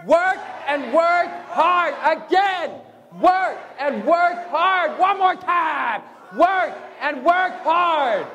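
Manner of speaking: high, slow shouted chant; so loud. Shown as a demonstration. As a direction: lead the crowd at full volume. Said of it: "say it so loud".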